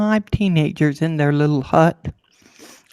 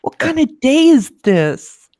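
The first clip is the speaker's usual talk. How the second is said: high, slow, drawn-out exasperation; exasperated oh my god; 10 semitones higher, 3 dB louder, slower than usual. Retold or imitated. Spotting a motorcycle cop behind her, high drawn-out exasperation. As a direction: wail at a bad day, hammed-up. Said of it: "I'm like".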